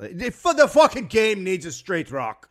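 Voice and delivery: booming and slow, laughing; loud laughing shout. Acted. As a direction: belt it out with a laugh.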